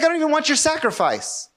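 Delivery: high-pitched, dismissive rejection voiced for God; exasperated, high-pitched protest; indignant refusal. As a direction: refuse the offering outright, pitch jumping high. Voicing God refusing a sacrifice.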